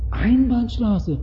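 soft, high, drawn-out mock realisation; clueless driver. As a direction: play a driver feigning innocent surprise. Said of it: "Er sagt, Ach".

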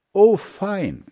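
high, loud cry of delight; delighted exclamation. A narrator's reading. Children answer Mother's offer of cocoa, exclaiming happily. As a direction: exclaim it like two pleased children. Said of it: "riefen sie beide".

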